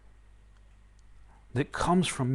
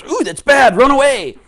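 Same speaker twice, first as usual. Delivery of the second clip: loud, high, panicky squeal; mock alarm; 10 semitones higher, 15 dB louder than usual. Teacher mocking a student who flees excitement, loud high squeal. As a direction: yelp it like someone fleeing a feeling. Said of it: "Excitement comes".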